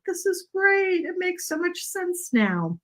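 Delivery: light up as it finally clicks; re-enacting her own eureka moment; excited realization. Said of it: "I'm like".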